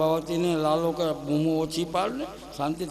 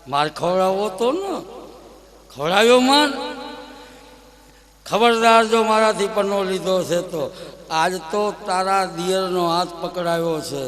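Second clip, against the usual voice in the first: louder, mocking child's boast; cheeky taunt; 4 dB louder than usual. Little Krishna taunts Prabhavati, cheeky gloating. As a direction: gloat over the trick.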